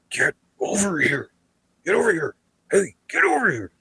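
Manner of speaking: high, strained imitation of a frightened friend; urgent hushed call. Nervous friend calling the narrator back, urgent hushed call. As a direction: beckon urgently without raising your voice.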